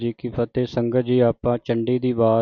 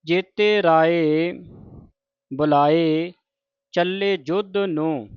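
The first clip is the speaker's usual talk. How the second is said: measured, chanted reading of sacred verse; scripture recitation; 3 semitones higher, slower than usual. Shown as a demonstration. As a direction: recite each line deliberately, with pauses.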